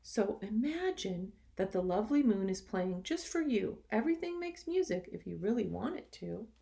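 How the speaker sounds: lively, expressive storybook reading; reading to children. A narrator's reading. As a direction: read it to the class with energy. Teacher reads picture book aloud.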